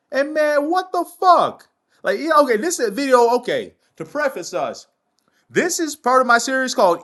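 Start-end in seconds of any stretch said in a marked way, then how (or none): 0.1-1.7 pitched way up, disbelieving swear; stunned outburst. Real, not acted. Host reacting to death toll, shocked higher outburst. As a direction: blurt it out, reeling from the headline. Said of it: "I'm in shock, man".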